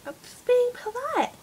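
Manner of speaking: high mock-sweet voice of Mum. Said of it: "And she was like".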